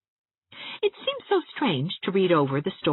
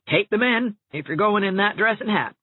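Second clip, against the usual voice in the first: Rebecca Dew advising; 5 semitones higher than usual. A narrator's reading. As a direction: give blunt practical advice. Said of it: "Rebecca Dew had advised".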